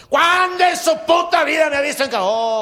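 furious, very high and loud; angry shout. Acted. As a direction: yell the denial in a rage. Compadre angrily denies being angry. (grito furioso, muy agudo y fuerte)

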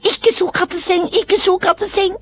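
put-on very high toddler voice; high-pitched little-boy protest; protesting small child. A narrator's reading. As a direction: wail it in a tiny high child's voice. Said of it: "for han opp og sto på kne og sa".

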